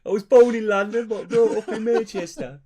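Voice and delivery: loud, high mock London accent; hammed-up accent. Co-host joining the joke about his accent, hammed-up London accent. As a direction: play up your own mixed accent, loudly.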